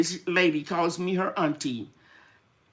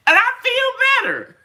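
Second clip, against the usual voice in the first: much higher and louder, beaming; excited; 8 dB louder than usual. Real, not acted. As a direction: announce it with bright delight. After a laugh about dressing up.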